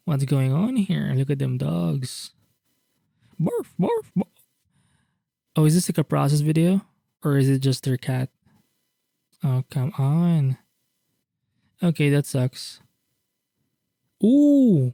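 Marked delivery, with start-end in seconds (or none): 3.4-4.2 high squeaky woof woof; playful high bark imitation; dog barks. Imitating dogs in art. 14.2-14.9 high loud delighted ooh; excited ooh. Real, not acted. Delighted at a page.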